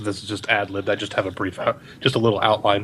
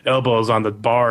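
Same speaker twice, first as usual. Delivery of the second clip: usual speaking voice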